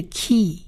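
aspirated k, long ee in "key"; model pronunciation. Shown as a demonstration. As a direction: say "key" three times, each set apart. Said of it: "第一个字是".

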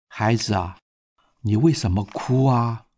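put-on softly, lowering the voice to comfort a crying child; gentle and soft. A narrator's reading. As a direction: lean in and speak gently. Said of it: "很轻柔地说". (轻柔地安慰哭泣的孩子)

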